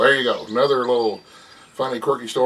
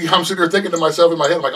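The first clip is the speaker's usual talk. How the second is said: ordinary talk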